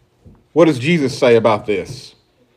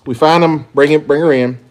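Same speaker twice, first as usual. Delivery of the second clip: normal talking voice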